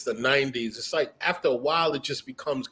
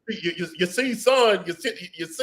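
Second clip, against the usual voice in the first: Foghorn Leghorn; 8 semitones higher than usual. Retold or imitated. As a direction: bluster like a cartoon rooster.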